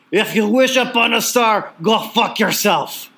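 put-on Iron Sheik accent. Host quoting the Iron Sheik's wisdom in his accent. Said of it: "I'll try to do it in his accent".

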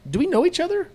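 sudden high, puzzled question; surprised guest. Retold or imitated. Diner greeted warmly, surprised and puzzled. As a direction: blurt it as pleasantly surprised. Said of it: "is for them to go".

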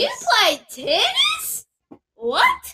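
high, loud, stunned question; can't believe what she just heard; shocked disbelief. Real, not acted. Shocked her friend plays tennis.

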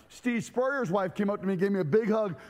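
ordinary talk, nothing marked

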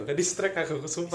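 talking through suppressed laughter; trying not to laugh while speaking; stifled giggles. Real, not acted. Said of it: "Jangan ketawa".

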